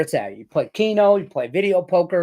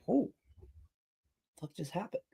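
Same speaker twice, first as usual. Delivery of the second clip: stunned disbelief; 4 semitones higher, 14 dB quieter than usual. Retold or imitated. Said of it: "I'm like".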